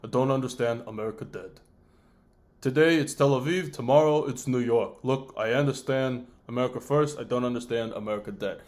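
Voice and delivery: a hint of a grave Netanyahu threat; lightly imitated Netanyahu, menacing; Netanyahu impression. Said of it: "Netanyahu angry at Americans".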